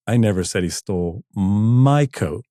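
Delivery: "my" long and punched; stress on MY. Shown as a demonstration. DJ showing ways to say one line, stress on "my". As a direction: lean heavily on "my". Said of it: "this many ways to say this".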